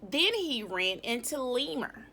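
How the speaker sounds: plain reading voice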